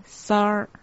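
"萨尔" as one syllable, sar, ending in r; pronounced sar. Shown as a demonstration. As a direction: say "萨尔" as sar, curling into an r. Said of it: "第一个字萨念成萨尔".

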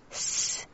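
"s" as a bare hissed consonant; pronounced s. Shown as a demonstration. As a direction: say "s" alone, with no vowel. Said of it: "硕这个字念成".

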